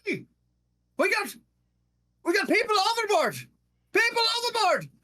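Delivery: high, fast gnome cry of people overboard; gnome lookout shouting. Acted. Gnome lookout calls to crew, high alarmed shout. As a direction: yell the alarm as a little gnome. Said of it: "pull himself up on the rafters and go".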